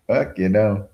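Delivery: ordinary talk, nothing marked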